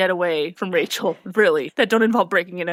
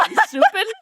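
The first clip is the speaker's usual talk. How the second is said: high laughing accusation; giggling, high-pitched jab; laughing tease; 12 semitones higher than usual. Acted. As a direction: tease him through a laugh. Teasing Luke, laughing.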